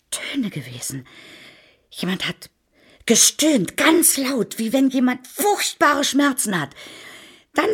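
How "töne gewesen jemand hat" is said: low, ghostly whisper; a creepy hushed telling. Acted. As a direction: drop to a softer, lower whisper. Describing eerie sounds in cellar.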